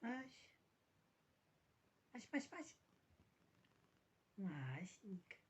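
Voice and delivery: soft cooing to a sleepy cat. Real, not acted. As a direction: hush and coo tenderly.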